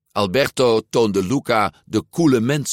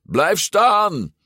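high, drawn-out shouted order; bellowed; 11 semitones higher, 4 dB louder than usual. A narrator's reading. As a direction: holler it with a long last word. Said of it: "brulde Alberto". (brullend bevel)

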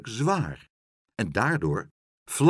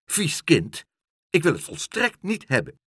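higher-pitched, indignant telling-off; scolding mother; 6 semitones higher, 4 dB louder than usual. A narrator's reading. Mother scolding her child over liquorice, indignant cry. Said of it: "riep haar moeder".

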